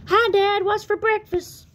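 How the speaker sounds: put-on high, loud child's call; a little one calling to his dad for attention; excited little tadpole. Tad shows off to his dad, high and loud.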